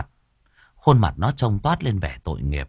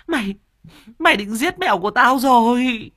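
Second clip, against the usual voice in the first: high screech, louder, stressing mày then falling; furious stepmother shriek; 4 dB louder than usual. Acted. Stepmother repeating her accusation at the boy, high falling shriek.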